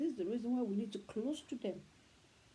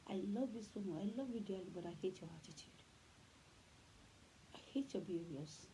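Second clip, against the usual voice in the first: put-on softer, tender, loving but firm words to a child; tender to my kid; 3 semitones lower, 8 dB quieter than usual. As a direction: say it gently, like holding the child close. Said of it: "I always tell my kid".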